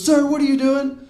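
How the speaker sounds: imitated officer, high and loud; retold alarmed demand. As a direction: call out like a startled cop confronting someone. Retold officer confronts the rock thrower.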